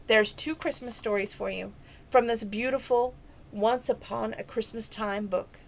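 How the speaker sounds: ordinary delivery, nothing marked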